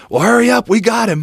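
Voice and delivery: high, loud hurry-up call; urgent call. Retold or imitated. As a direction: push him to get moving now. Alex urging him on phone.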